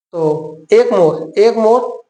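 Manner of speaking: "एक मोर" said very slowly, split in two words; slow split reading. Shown as a demonstration. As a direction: break "Egmore" into "एक मोर" slowly. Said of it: "Egmore को कैसे पढ़ेंगे?".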